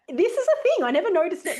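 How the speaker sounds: high, loud burst of realization; excited realization. Real, not acted. Sudden realization after laugh.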